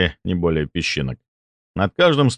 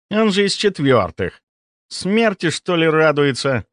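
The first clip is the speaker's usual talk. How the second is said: put-on young noble voice; 9 semitones higher than usual.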